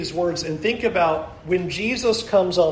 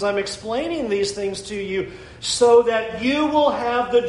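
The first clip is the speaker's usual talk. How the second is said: raised, intense preaching voice; pitched-up preacher urgency; 6 semitones higher than usual. Acted. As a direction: drive the point home to the congregation.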